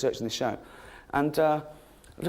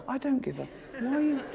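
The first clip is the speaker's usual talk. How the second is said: usual speaking voice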